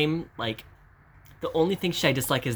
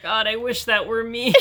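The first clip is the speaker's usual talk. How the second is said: wistful high exclamation; 10 semitones higher, 3 dB louder than usual. Real, not acted.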